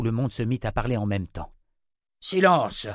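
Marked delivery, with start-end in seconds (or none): 2.2-2.9 high, loud command; shouting. A narrator's reading. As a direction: shout down a noisy group. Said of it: "cria Chase".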